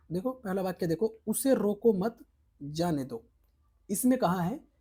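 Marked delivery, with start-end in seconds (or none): 1.3-3.3 "रोको मत" held together, then a pause before "जाने दो"; group मत with रोको, then pause; pause after मत. Shown as a demonstration. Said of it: "वाक्य के अर्थ को मिनटों में बदल देते हैं".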